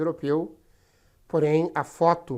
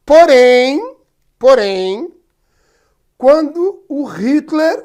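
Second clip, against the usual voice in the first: emphatic; 10 semitones higher, 12 dB louder, much slower than usual. Real, not acted. Teacher builds up to key point.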